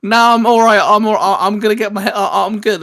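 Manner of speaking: high, loud mock protest; mimicked reluctant refusal.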